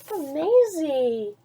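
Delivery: ordinary delivery, nothing marked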